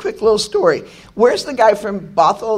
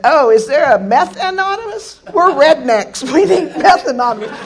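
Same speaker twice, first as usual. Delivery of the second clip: laughing retelling; 7 semitones higher, 6 dB louder than usual. Retold or imitated. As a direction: crack up while quoting them. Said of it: "they said".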